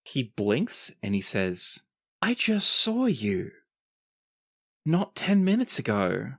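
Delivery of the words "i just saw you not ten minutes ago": higher, slowed, puzzled insistence; baffled disbelief. Acted. Revived scout to strangers he just saw, baffled. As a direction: say it slowly, voice lifted in bewilderment. Scout wakes confused, acted by game master.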